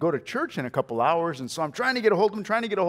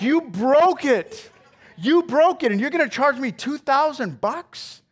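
raised, indignant disbelief, slow on the first words; 7 semitones higher than usual. Retold or imitated. Scene: to the plumber who broke the pipe, indignant raised voice. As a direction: let him hear your outrage at the bill.

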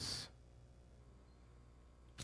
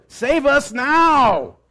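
slow, higher, drawn-out plea; pleading cry; 7 semitones higher, 4 dB louder, much slower than usual. Acted. Preacher voicing the crowd begging for rescue, slow and high. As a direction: stretch each word out like a prayer.

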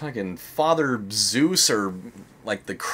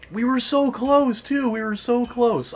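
dismayed lament; 12 semitones higher, 3 dB louder than usual. Real, not acted. Player laments a near win.

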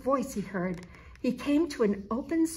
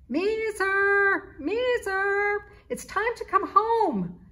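high, loud call across the yard; 11 semitones higher, 6 dB louder, slower than usual. A narrator's reading. Mother cat Misha calling her kitten home, loud call. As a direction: call him home from the porch. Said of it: "Misha called out".

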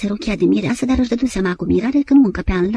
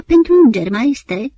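high, loud, near pathetic cry; moved exclamation; 4 semitones higher, 5 dB louder than usual. A narrator's reading. Maria answers the old maestro, moved, high and loud. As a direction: burst out with emotion, almost pathetic. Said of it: "exclama emoționată, aproape patetic".

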